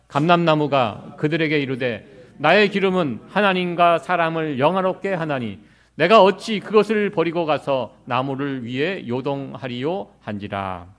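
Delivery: loud, measured verse reading aloud; scripture reading. Acted. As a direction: read the verse out together with the congregation, slowing at the end. Congregation reads the verse together.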